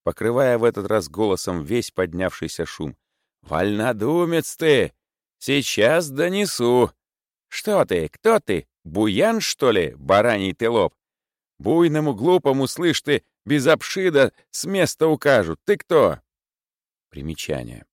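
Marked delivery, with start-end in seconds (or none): 3.4-6.9 high, slow mock threat; drawn-out accusation. A narrator's reading. Zimoveikin threatening to denounce Senya, drawn out. 7.5-16.2 high, loud peevish retort; scolding sick clerk. A narrator's reading. Prokharchin scolding Zimoveikin, raised and peevish.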